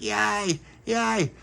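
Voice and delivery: high little yay; excited cheer. A narrator's reading. Dolores cheering the treat, high and excited. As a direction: squeal it happily.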